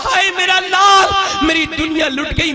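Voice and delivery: high, loud, slow lament; slow high lament of a bereaved mother; grieving wail. Acted. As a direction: wail it out for a lost son. Mother finds son dead.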